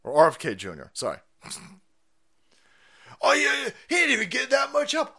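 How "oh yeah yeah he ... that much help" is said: high, fast sneering mimicry; mocking high voice. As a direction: whine it back mockingly. Mocking the left again.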